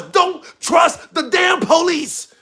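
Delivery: slow, high shouted warning from a dad; slow shouted fatherly warning; dad's warning. Retold or imitated. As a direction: bark the warning slowly like a stern father. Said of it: "the first thing my dad ever told me was".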